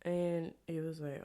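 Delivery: usual speaking voice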